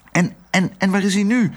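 put-on high, coaxing girl voice; sweet little girl. A narrator's reading. Otje coaxing Herman, high and sweet. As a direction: charm the dog gently.

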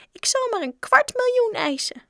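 tiny, very high pipsqueak; squeaky little voice. A narrator's reading. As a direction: squeak it from the back. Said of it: "zei een piepstemmetje achteraan". (hoog piepstemmetje)

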